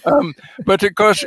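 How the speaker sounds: ordinary talk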